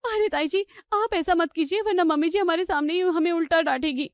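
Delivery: high-pitched, anxious appeal; worried pleading. Acted. Worried niece pleads with angry aunt. (घबराई हुई, ऊँचे सुर में मिन्नत)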